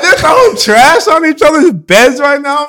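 loud, high-pitched incredulous yell; retold outrage. Man retelling his cellmates' trash fight, loud outrage. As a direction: exclaim it high and loud, half laughing.

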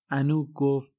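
plain reading voice

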